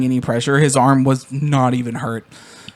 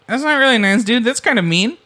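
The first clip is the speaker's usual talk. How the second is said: high mock hurt; 8 semitones higher than usual. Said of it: "And I was like".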